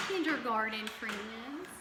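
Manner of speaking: ordinary talk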